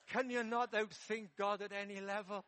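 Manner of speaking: loud, acted exasperation; exasperated retort. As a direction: protest loudly, as if fed up. Acted exasperated retort in his thoughts.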